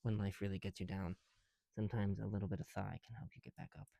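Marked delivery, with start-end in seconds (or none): none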